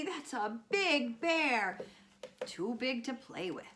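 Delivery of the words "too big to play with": ordinary delivery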